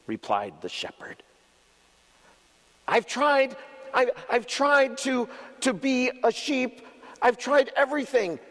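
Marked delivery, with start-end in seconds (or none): 2.9-8.4 much higher, pleading little goat; high, distressed little goat voice; little goat crying out. Acted. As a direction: plead like a desperate small child. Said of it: "cried the little goat".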